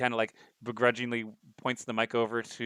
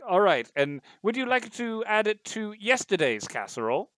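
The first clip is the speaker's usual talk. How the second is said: computer butler voice; 10 semitones higher, 4 dB louder than usual. Acted. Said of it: "And they're like".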